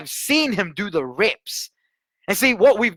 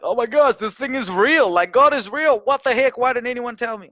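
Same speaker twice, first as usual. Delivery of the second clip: higher, excited retold amazement; amazed; 5 semitones higher, 3 dB louder than usual. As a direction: gush like a new believer discovering it all. Retelling shock at spiritual reality.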